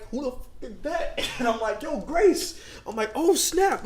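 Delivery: high-pitched retold surprise; startled recognition. Spotting a girl from the past mid bench press, startled.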